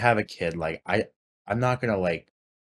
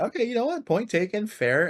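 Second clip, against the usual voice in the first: mock concession. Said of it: "so I was like".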